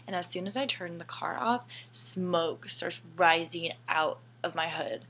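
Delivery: normal talking voice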